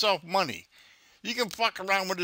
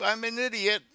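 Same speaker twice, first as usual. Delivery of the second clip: a light put-on higher voice of the scammer; mocking the scammer; 6 semitones higher than usual. Man voicing the scammer for YouTube, lightly mocking. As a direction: play the dumb thief mockingly.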